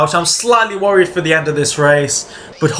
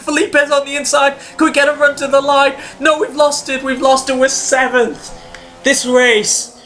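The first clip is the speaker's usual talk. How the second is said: much higher, near-shouting panic; frantic excitement; 8 semitones higher than usual. Real, not acted. Gamer losing sixth place on the line, higher frantic shouting. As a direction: yell it as the place slips away. Losing position in final corners.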